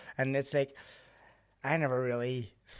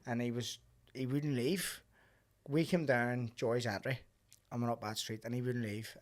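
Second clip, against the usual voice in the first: ordinary talk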